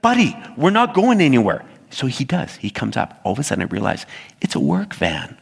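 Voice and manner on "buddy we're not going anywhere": annoyed driver. Retold or imitated. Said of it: "I'm getting annoyed".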